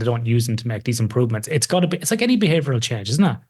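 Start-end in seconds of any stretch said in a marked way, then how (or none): none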